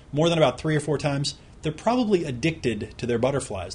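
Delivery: normal talking voice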